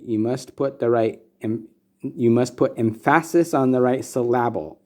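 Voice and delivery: "emphasis" as em-FASS-is, "syllable" as sil-LAB-le; father's humorous mispronunciation. Retold or imitated. Son quoting what his father would say, humorously misstressing "emphasis" and "syllable". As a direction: misplace the stress onto the middle of "emphasis" and "syllable". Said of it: "Humorously, he would say that".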